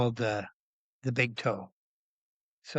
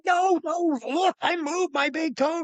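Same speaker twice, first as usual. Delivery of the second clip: high, urgent protest; worked-up pleading to be believed; retold insisting. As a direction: insist excitedly, voice pitched way up. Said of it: "I'm going".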